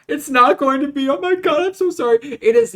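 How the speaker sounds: high, loud, panicked apology; frantic outburst. Real, not acted. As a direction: blurt it out in a rising squeal. Frantic outburst about cancelled video.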